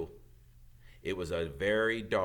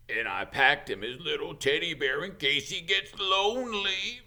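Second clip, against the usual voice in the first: Hagrid voice; 7 semitones higher, 4 dB louder than usual. A narrator's reading. Hagrid farewell.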